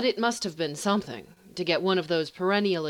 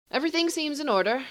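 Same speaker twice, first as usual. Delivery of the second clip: much higher, quick dismissive sorcerer; haughty sorcerer; 10 semitones higher, faster than usual. Acted. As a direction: brush it off lightly. Sorcerer, high and fast.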